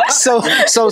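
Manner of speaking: giggling through the words; laughing. Real, not acted. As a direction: laugh while you say it. Laughing at crunch sound.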